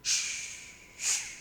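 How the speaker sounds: soft unvoiced whooshing wind; wind noise. Pastor makes the congregation hear the wind, soft whooshing. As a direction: breathe out the sound of the wind. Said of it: "imagínese el viento".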